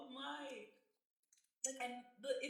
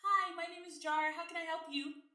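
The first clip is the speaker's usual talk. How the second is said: bright customer-service voice, much higher; high phone voice; 8 semitones higher, 4 dB louder than usual. Shown as a demonstration. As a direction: lift the voice up for a stranger on the phone. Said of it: "my voice goes up three octaves".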